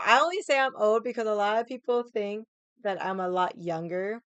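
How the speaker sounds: usual speaking voice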